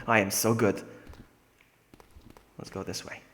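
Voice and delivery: ordinary talk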